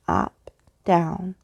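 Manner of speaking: ordinary delivery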